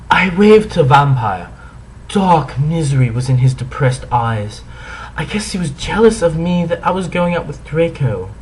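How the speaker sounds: ordinary delivery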